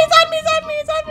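high, frantic repeated cries; panicked squeal. Pretend. Monster targets the player.

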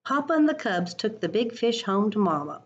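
expressive picture-book read-aloud; reading to children. Acted. Teacher reading a picture book to her class, lively storytime voice.

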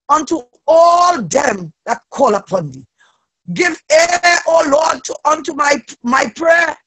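ordinary delivery

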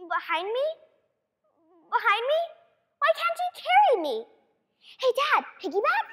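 put-on high, pleading child voice; a kid begging Dad to carry her; whiny little girl. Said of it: "child pleading with dad".

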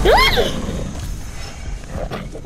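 sharp frightened gasp; startled gasp. Real, not acted. Startled by something in game.

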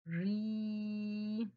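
ري with the vowel held long; stretched syllable. Shown as a demonstration. Teacher to pupils, holding the long vowel of ري. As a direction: hold the ي of ري out. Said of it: "حرف الراء ومديته شوية".

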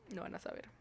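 lo van a saber whispered, voiceless and very soft. Shown as a demonstration. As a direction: breathe it as a secret.

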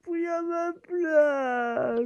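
high, long-drawn wail of complaint; aggrieved whine. Real, not acted. Complains about another player in game.

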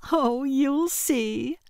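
high, slow, gushing sing-song; burbling. A narrator's reading. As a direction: bubble over with secret glee. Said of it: "Loretta burbled".

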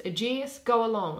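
ordinary delivery

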